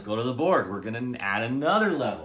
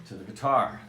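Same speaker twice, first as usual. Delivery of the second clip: ordinary delivery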